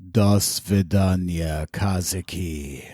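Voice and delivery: put-on slow, low, heavy Russian villain accent. Co-host signs off to Patrick in a slow, low cartoon Russian accent. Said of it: "you could be a voice actor in Rocky and Bullwinkle".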